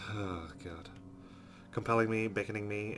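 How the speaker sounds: normal talking voice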